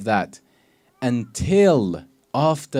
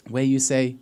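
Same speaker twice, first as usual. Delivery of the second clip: ordinary talk, nothing marked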